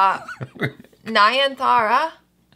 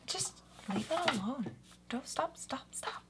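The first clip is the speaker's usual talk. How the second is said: hushed whisper; 2 semitones higher, 19 dB quieter than usual. Real, not acted. Hushing co-host about hair.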